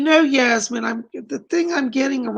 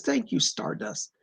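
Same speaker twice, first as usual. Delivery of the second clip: ordinary talk, nothing marked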